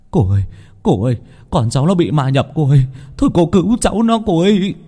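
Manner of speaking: distraught parent's plea; desperate pleading. Acted. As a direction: beg frantically for your child's life. Parents beg healer to save daughter.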